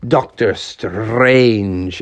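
Doctor Strange drawn out very slowly; dramatic name reveal. A narrator's reading. Storyteller revealing the Marvel figure, grandly drawn out. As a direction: stretch out STRANGE for grand effect.

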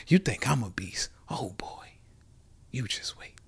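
a playful, menacing whisper. Acted. Teasing future kids with a mock-ominous whisper. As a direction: lean in and whisper the threat.

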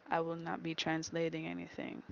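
ordinary delivery

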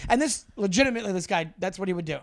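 normal talking voice

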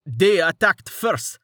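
an angry son justifying himself; shouted angrily. A narrator's reading. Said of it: "one of the sons shouted angrily".

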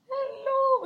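high, loud sing-song hello; drunk woman greeting. Retold or imitated. Drunk heckler greeting the compere, high and loud. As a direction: gush it like a happy drunk.

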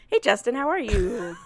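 normal talking voice

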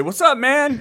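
high, bright casual greeting; imitating a breezy hi to the gas station guy. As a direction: call out a friendly hello. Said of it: "just say".

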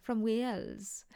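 hushed slow whisper; mock whisper. Hosts teasing each other in a hushed whisper. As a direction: breathe it out secretly.

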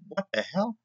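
a stunned what the hell from a colleague; shocked outburst. Retold or imitated. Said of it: "and they're going".

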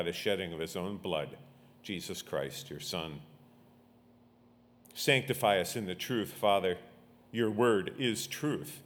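low, hushed congregational prayer. Real, not acted. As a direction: pray softly and reverently, dropping low at each close. Leads a prayer.

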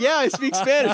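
high, loud mock boast; playful imagined stranger. Imagining a stranger abroad announcing their language, playfully loud.

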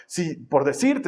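ordinary talk, nothing marked

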